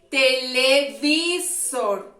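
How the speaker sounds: Televisor drawn out slowly and loudly. Shown as a demonstration. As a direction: sound out Televisor slowly for the class. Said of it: "Vamos a escoger".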